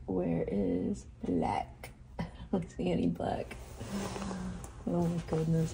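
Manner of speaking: ordinary talk, nothing marked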